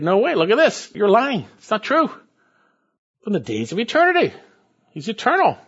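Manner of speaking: high, loud, fervent rebuttal; preacher scolding the heretics; indignant preaching. Acted. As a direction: shoot down the false claim with fire.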